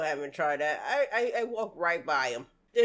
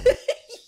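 short, loud, high laugh; one loud yelp of a laugh; sharp high laugh burst; 10 dB louder than usual. Real, not acted. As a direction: blurt out a quick squeal of laughter. Laughs at the meme.

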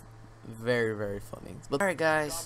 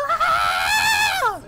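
loud, very high-pitched mock scream; imitated scream; 10 dB louder than usual. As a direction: let out a piercing imitation scream. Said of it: "like, screaming".

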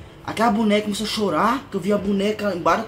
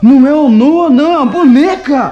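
loud, high-pitched realization; scared shout; 6 semitones higher, 14 dB louder than usual. Real, not acted. Husband discovering the crying thing is a doll, loud and scared. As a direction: shout it out in relief and fear.

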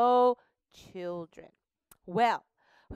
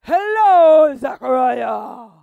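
loud, slow angelic greeting; booming angel; 17 dB louder, slower than usual. Acted. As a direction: call out grandly as the angel. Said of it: "Gabriel said to Zechariah".

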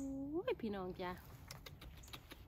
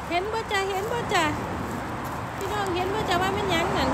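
excited; 8 semitones higher, 7 dB louder than usual. Real, not acted. Spots mushrooms by the road, thrilled.

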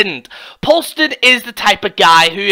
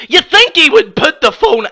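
ordinary talk, nothing marked

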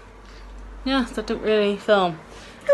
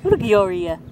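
put-on dog baby talk; 2 semitones higher than usual.

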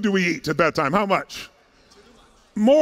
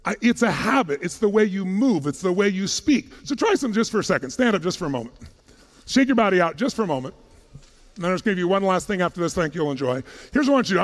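ordinary talk, nothing marked

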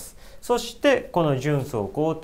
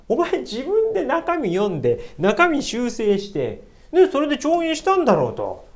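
indignant scolding; 9 semitones higher, 4 dB louder than usual. Real, not acted. Indignant outburst at the emperor.

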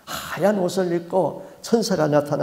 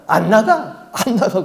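laughing, louder refusal; a gloating demon cackling no; mocking laugh; 3 semitones higher, 6 dB louder, slower than usual. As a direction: taunt them through a laugh.

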